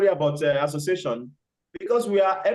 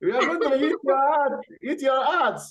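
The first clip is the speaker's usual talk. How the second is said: gloating crocodile; 6 semitones higher than usual. Acted. Crocodile reveals his plan.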